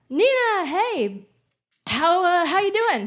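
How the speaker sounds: high, loud, over-eager greeting; acted dad on phone. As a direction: imitate a flustered dad covering up.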